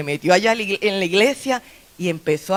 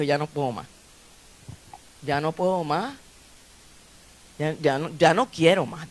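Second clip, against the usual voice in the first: despairing; 5 semitones lower, 5 dB quieter than usual. Acted. Ana despairing, retold in sermon.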